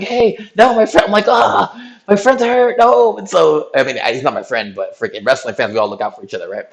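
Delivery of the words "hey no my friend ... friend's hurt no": high, loud protest while being spun around; retold panicked yell. Narrator protesting to the guard twirling him, high and loud. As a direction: yell over the guard's bear hug.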